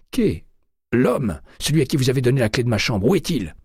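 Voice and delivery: high and loud, hammering the demand; furious yell. A narrator's reading. McCaleb demanding where the intruder is, loud and high. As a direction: keep shouting him down. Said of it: "hurla-t-il".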